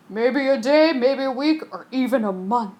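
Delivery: put-on lightly gruff old captain. Captain answering the passenger. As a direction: shrug it off like a sea dog.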